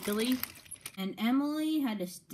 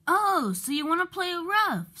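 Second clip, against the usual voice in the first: taunting; 10 semitones higher, 5 dB louder than usual. Acted. Emily taunting Thomas.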